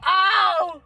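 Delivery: high mock "ow" of pain; sudden pained ow; imitated drunk yelp. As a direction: yelp like a drunk man hurting. Drunk dad yelping again.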